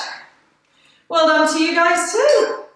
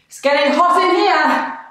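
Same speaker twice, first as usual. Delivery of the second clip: ordinary talk, nothing marked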